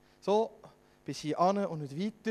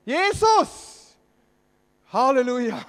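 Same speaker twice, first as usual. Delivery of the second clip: full-voiced, much higher and louder cry; louder shout; 5 semitones higher, 11 dB louder, slower than usual. Preacher repeats the beggar's cry to wake the congregation, full shout. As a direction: shout it even harder to wake everyone. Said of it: "Soll ich nochmal?".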